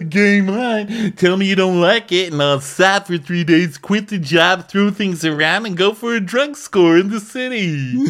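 hammed-up chef spiraling; mock frantic chef. Podcaster imitating chef ranting at guests, high and manic.

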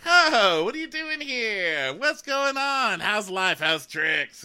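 high, drawn-out singsong hello; hammed-up chirpy small talk; oblivious cheery greeting. [name] greeting Sam as he sits crying, oblivious and cheery.

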